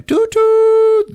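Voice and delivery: a very high, slow, loud toot; train whistle imitation. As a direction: mimic a steam whistle.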